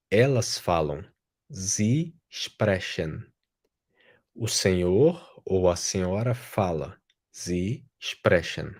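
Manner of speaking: ordinary delivery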